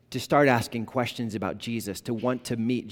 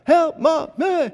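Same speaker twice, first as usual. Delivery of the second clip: high, loud panicked call for Mom; a lost little boy screaming for his mother; terrified child crying out; 7 dB louder, slower than usual. Retold or imitated. Lost boy at the hockey game calling for his parents, high panicked cry.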